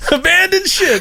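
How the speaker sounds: high shout breaking into laughter; laughing shout. Pretend. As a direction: yell it while cracking up. Repeat cry, laughing.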